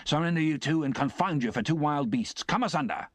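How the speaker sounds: gruff soldier barking orders; stern sergeant shout. Acted. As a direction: command them to surrender. Sergeant shouting at fighting convicts.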